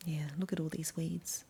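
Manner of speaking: soft hushed aside; whisper. Real, not acted. Reader pointing out weeds to children, whispered.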